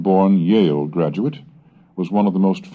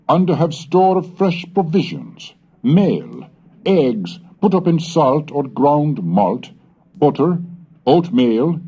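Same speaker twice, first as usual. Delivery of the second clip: measured, slightly raised reading of a colonial letter; reading an old letter; 7 semitones higher, 3 dB louder than usual. Acted. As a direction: read it like a dignified seventeenth-century husband writing home. Reads an old letter aloud.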